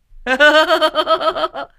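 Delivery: high, loud burst of laughter; big laugh. Real, not acted. The streamer laughs at his dying-prank joke, a loud burst of laughter. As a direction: cracks up hard at his own punchline.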